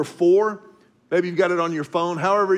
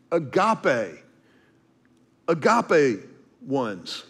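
ordinary talk

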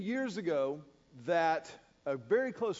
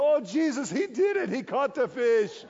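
joyful worship; 2 semitones higher, 6 dB louder than usual. Retold or imitated. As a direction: cry out thanks in delight. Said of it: "my guide begins worshiping there on the flats".

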